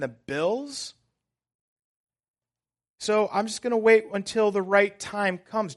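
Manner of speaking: high, loud, urgent sermon delivery; impassioned pulpit exhortation; full-force preaching. Real, not acted.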